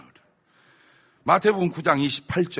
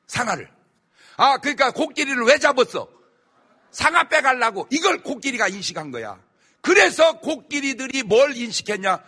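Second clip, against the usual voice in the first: preaching; 8 semitones higher, 7 dB louder than usual. Acted. Preacher raising voice on elephants.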